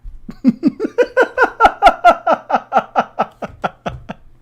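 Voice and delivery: long high squealing laugh. Real, not acted. Streamer cracking up over bad photos, long squeal of laughter. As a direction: let a giggle run on and up.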